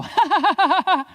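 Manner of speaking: loud, high forced "ha ha ha"; big staccato mock laugh; demonstrated laugh. Shown as a demonstration. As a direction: lead the crowd in fake laughter. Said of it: "Everyone go".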